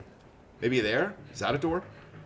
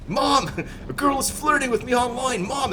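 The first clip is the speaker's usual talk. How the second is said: mock whiny kid; 10 semitones higher than usual. Mocking a kid calling mom.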